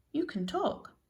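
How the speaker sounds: high, incredulous question; astonished. A narrator's reading. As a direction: pitch leaps up in amazement. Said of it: "stared in astonishment".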